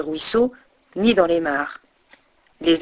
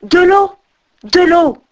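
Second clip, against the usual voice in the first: high, loud delighted cry; thrilled shout of discovery; joyful exclamation; 7 semitones higher, 5 dB louder, slower than usual. A narrator's reading. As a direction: call it out with sudden joy. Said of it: "il s'exclama tout joyeux".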